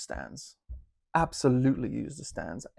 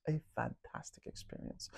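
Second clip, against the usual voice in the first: softer breathy whisper trailing away; fading whisper; 13 dB quieter than usual. Real, not acted. Reviewer echoing his promise to viewers, softer whisper. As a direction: let the repeat fade into a whisper. Repeats the phrase in a fainter whisper.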